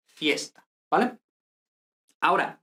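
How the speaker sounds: ordinary talk, nothing marked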